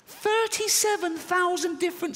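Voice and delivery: Thirty-seven thousand said way up high in panic; mock-terrified high-pitched repeat; high squeal. Shown as a demonstration. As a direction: squeal the number in horror. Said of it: "repeated in high voice".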